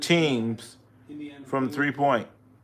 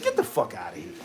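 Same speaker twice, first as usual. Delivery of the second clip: low dismissive brush-off; 7 semitones lower, 8 dB quieter, much faster than usual. Retold or imitated. Team rejecting the trade offer.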